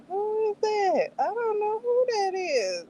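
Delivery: high puzzled voice, not recognising Kenji; puzzled who-is-that. Retold or imitated. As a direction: ask it high, truly lost. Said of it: "I'm like".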